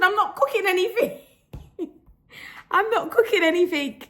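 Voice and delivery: high-pitched indignant denial; retold protest. Retelling her denial to her man about smoke, mock protest. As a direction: protest high, half laughing.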